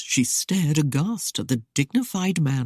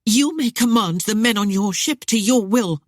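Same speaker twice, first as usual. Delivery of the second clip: raised, louder defiant retort; hurled answer; 6 semitones higher, 5 dB louder than usual. A narrator's reading. Proud lady defying the ship captain, raised and louder. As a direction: fling the words back at him. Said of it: "Arienne hurled back her answer".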